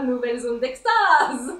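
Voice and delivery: slow, laughing moan of pleasure; blissful moan. Real, not acted. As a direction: melt into the massage, half laughing. Ecstatic reaction to massage gun.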